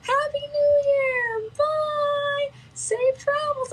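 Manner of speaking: put-on high sweet kid voices calling goodbye; little kid voices. Children waving to the other ship, slow high child voices. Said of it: "little kid voices".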